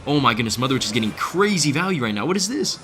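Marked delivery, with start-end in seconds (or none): 2.3-2.8 can't believe what the card is doing; stunned gamer yelp; shocked disbelief. Real, not acted. Streamer shocked by enemy card.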